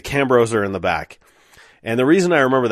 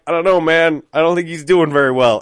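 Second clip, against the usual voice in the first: high, badly faked distress; manufactured emotion; 6 semitones higher, 6 dB louder than usual. Narrator lying to manager about buddy, faked emotion. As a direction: put on unconvincing worry, pitched up and louder. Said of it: "I tried to, like, manufacture some emotion".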